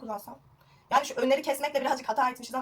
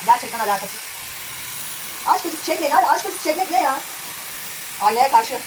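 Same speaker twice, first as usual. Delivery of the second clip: raised voice over a running appliance; talking loudly; 5 dB louder, slower than usual. Real, not acted. Vlogger chatting to viewers over the noise, raised voice. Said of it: "bağırarak konuşuyorum".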